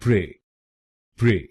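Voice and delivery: "प्रे" as a short, light "pri"; correct first syllable. Shown as a demonstration. Teacher models the first syllable PRE, correctly pronounced. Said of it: "पहले शब्दांश को यानी पीआरई हम बोलेंगे".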